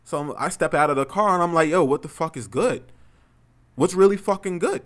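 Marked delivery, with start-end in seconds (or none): 1.7-4.8 loud, raised-pitch challenge; retold aggressive call-out; confrontational challenge. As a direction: square up and dare him to answer. Squaring up to the driver.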